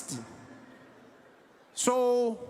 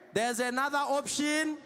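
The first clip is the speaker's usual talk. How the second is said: slow coaxing negotiator; 5 dB quieter than usual. Acted. Negotiator coaxes the suspect slowly.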